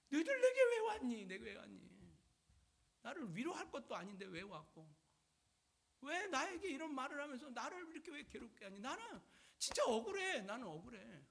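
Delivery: acted plaintive complaint, opening loud and high; aggrieved protest. Job answering his accusing friends, aggrieved protest. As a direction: protest like someone wronged. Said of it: "욥은 또 이야기합니다".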